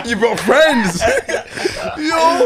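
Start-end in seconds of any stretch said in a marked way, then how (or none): 1.1-1.9 a breathless, very high wheeze of laughter; airy high giggle. Real, not acted. Group cracking up at "you brought friends.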